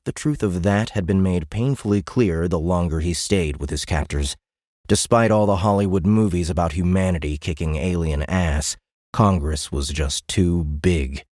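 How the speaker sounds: plain reading voice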